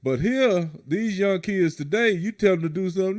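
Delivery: ordinary talk, nothing marked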